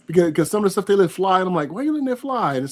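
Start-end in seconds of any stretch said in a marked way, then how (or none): 1.7-2.6 pitched way up in mock disbelief; incredulous question. Complaining to a friend about YouTube rules, high incredulous mock question. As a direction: ask it like you can't believe what they allow. Baffled at YouTube's double standard.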